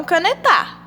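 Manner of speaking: canetar said as cane-TÁ; wrong stress on final TA. Shown as a demonstration. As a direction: throw the stress onto the last syllable of canetar. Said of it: "Ou então". (canetar com a última sílaba forte)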